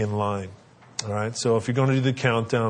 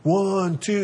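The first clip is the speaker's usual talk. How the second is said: higher, impatient calling out; yelling dad; 8 semitones higher than usual. Retold or imitated. Dad at the playground calls his kid, impatient and high. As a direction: call the kid down, counting. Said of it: "and yelling at his kid".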